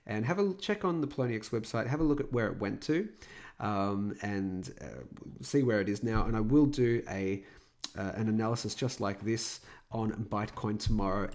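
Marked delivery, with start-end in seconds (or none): none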